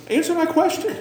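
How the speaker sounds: high, laughing mock demand; half-laughing, pretend fed up; playful fake frustration; mock exasperation.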